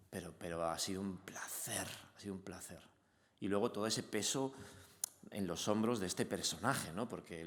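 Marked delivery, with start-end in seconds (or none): 0.1-2.8 soft whisper. Real, not acted. Moved thanks at premiere.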